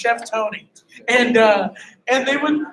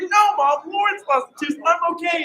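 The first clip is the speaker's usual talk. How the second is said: mock exasperated reassurance; 7 semitones higher than usual. Retold reassurance to panicking mom.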